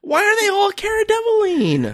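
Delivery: high, exasperated disbelief; incredulous outcry. Real, not acted. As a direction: squeal the question in disbelief. Repeats complaint, pitched up.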